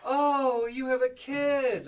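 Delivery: high, loud, drawn-out gush; gushing reaction. Acted. Bartender reacting to a customer's kid, exaggerated gushing. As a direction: stretch it out in an exaggerated squeal of reaction. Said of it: "there's definitely a different reaction".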